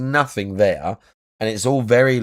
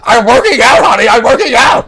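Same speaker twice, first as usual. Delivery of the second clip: panicked shout; 12 semitones higher, 10 dB louder than usual. Retold or imitated. DSP caught, voiced shouting.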